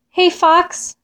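high-pitched call, no real volume; mild calling out. A narrator's reading. Mole calls up to Fox, mild shout. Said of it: "shouted Mole".

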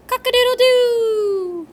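high, loud rooster call; hammed-up rooster crow. A narrator's reading. Man crows like a rooster.

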